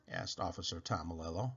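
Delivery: plain reading voice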